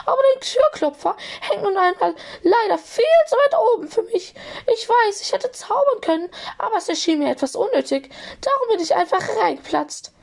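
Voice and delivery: very high, squeaky little voice; squeaky apology. A narrator's reading. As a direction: squeak out an over-polite apology. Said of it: "entschuldigte er sich quiekend".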